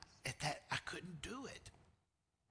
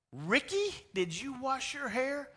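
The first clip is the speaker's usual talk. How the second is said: high, louder imitated mom; sing-song mom checking; mom asking; 12 semitones higher, 8 dB louder than usual. Mom asking Ricky at bedtime.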